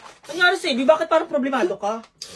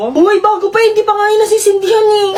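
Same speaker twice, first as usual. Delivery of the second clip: higher and louder, thrilled at the new candle; excited find; 7 semitones higher, 7 dB louder than usual. Acted. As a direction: gleefully point out it's unlit. (masayang-masaya at malakas)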